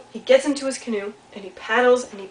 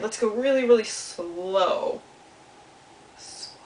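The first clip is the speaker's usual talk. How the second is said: really slow; slower than usual. Shown as a demonstration. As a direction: stretch the words out slower and slower, then fade. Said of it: "should we go really slow".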